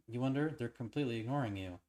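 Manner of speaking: steady, even storytelling read; story narration. A narrator's reading. Reading the game's story aloud to viewers, steady narration.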